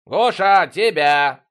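loud, high call across the flat; drawn-out shouted summons; yelling. A narrator's reading. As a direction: holler for someone in another room. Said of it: "заорал Витёк".

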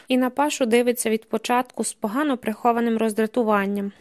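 plain reading voice

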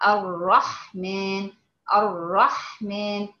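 الرحمن with the doubled raa stretched slowly; slow tajweed recitation of الرحمن; doubled r demo. Shown as a demonstration. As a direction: draw out the shaddah on the r of الرحمن. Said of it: "الراء مشددة".

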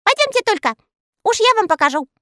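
high, knowing elf voice; sly elf voice. Acted. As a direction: promise a surprise in a tiny voice. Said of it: "Эльфы бросились гурьбой за Скоком". (хитро, тонким голосом)

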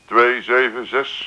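slow, spelled-out digits; dictating slowly. Acted. Caller dictating his number to the mother, slowly. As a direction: read the number so it can be written down.

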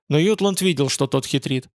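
usual speaking voice

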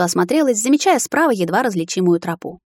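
ordinary delivery

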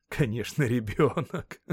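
amused man's mocking laugh. A narrator's reading. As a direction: break into laughter on the last word. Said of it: "Он снова засмеялся". (насмешливо, со смехом)

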